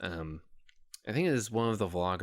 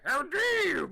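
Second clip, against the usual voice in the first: high squealing diva voice that slips toward a gruff monster growl; a first rough try at an indignant pig diva, much higher; Miss Piggy; 5 dB louder than usual. Retold or imitated. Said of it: "That was more of a Cookie Monster. My apologies, Miss Piggy".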